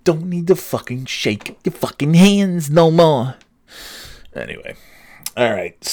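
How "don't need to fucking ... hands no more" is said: high, slow put-on voice; mock plea. Podcaster protesting handshakes, slow high put-on voice. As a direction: drag it out in a high comic character voice.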